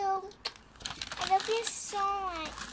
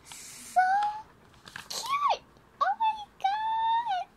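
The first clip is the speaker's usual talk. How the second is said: high-pitched delighted squeal, slower on oh my God; excited squeal; 6 dB louder, much slower than usual. Real, not acted. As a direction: squeal with joy at a cute gift. Squealing over a gift.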